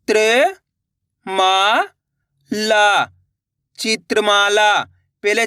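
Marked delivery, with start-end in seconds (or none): 0.1-3.0 त्र, मा, ला drawn out with gaps; syllable by syllable. Shown as a demonstration. Teacher breaking a word for learners, syllable by syllable. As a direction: sound out त्र, मा, ला singly. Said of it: "एक अक्षर का उच्चारण करके".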